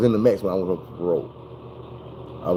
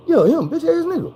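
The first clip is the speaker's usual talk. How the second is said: very high, squeaky mock buyer; buyer confirming. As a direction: chirp it back innocently.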